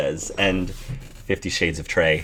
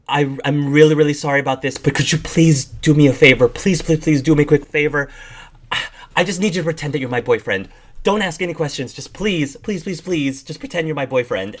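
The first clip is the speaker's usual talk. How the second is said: higher, fast, desperate begging; pleading bat woman; 5 semitones higher, faster than usual. Acted. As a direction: beg a stranger for a quick favor.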